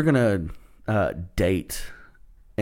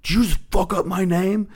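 offended comic; 9 semitones higher than usual. Retold or imitated. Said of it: "She was like".

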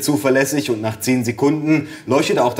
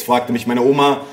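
usual speaking voice

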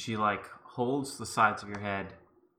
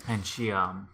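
ordinary delivery

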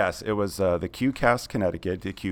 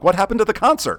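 puzzled outburst; 10 semitones higher, 6 dB louder than usual. Retold or imitated. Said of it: "I'm like".

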